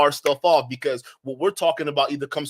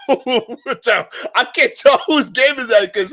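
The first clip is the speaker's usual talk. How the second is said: laughing, slow, higher and louder; real laughter while reading; 7 semitones higher, 8 dB louder, much slower than usual. Real, not acted. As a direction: laugh through the words at the joke. Laughing at super chat.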